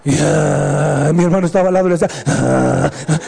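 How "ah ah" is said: low, drawn-out imitated sob; crying. Brother cries beside him, low mock sobbing. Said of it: "¿Cómo te quedas llorando?".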